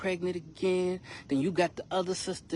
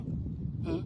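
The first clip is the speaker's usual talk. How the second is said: mocking hmm; 13 semitones higher, 6 dB quieter than usual. Sisters' challenging look.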